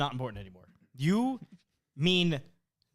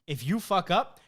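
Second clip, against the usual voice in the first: normal talking voice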